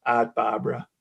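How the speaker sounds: "Art Barbara" in a Boston accent, dropped R's, "Aht Bahbra". Shown as a demonstration. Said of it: "funny name to say in a Boston accent".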